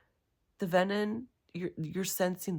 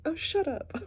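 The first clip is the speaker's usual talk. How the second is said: high, mocking brush-off; dismissive retort; 13 semitones higher than usual. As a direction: toss it off like a bored eye-roll. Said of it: "Now she's just like".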